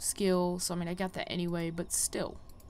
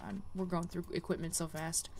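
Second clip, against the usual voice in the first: ordinary talk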